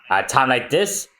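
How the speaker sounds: usual speaking voice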